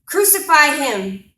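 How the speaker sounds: loud, high, drawn-out shout; crowd's scream. Acted. Mob calling for Christ's death in a poem, screaming. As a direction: shout it out as a mob's demand. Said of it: "they're screaming in my face".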